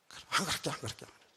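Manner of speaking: whispered, cowed promise; meek begging. Retold or imitated. Bully begging the big brother, cowed whisper. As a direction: shrink and whisper it. The bullied boy backs down.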